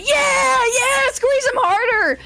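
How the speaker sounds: high, loud cheering fans; screaming crowd. Acted. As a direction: scream it like a bloodthirsty crowd egging on a wrestler. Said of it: "they're just screaming".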